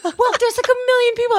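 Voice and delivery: high disbelieving squeak; incredulous high. Retold or imitated. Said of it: "I was like".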